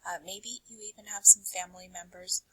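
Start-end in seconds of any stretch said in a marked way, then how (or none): none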